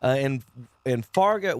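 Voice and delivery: ordinary delivery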